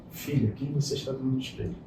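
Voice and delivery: soft reassuring father to his crying daughter; gentle father. Retold or imitated. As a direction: coax her quietly, like a patient dad.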